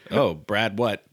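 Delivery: ordinary talk, nothing marked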